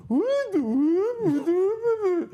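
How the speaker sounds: high, loud wailing caricature of a Belgian; mock Belgian impression. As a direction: wail it out like a hammed-up Belgian. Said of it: "then he did Belgian, and he was like".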